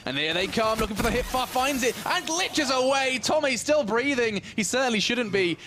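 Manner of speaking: high, rising match call; excited play-by-play. Real, not acted. Caster calls Tommy's narrow escape, excited.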